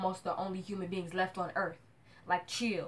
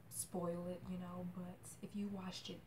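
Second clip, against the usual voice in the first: whisper; 12 dB quieter than usual. Acted. Conspiratorial aside to viewers.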